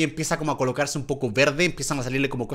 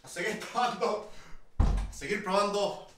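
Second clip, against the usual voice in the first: defeated and quiet; 6 dB quieter than usual. Real, not acted. Defeated after the rotten flavour.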